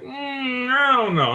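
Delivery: very high, loud mock doubt; squeaky mock unsure relative; evasive relative. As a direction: dodge it in a squeaky voice.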